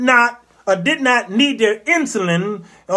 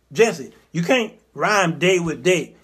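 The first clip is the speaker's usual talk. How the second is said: usual speaking voice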